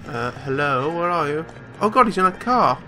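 ordinary delivery

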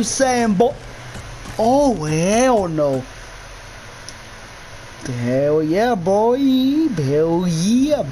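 normal talking voice

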